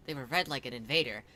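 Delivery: plain reading voice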